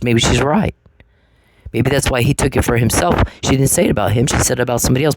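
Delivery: ordinary delivery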